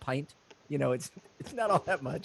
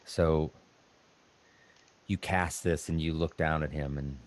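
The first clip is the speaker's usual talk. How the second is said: ordinary talk, nothing marked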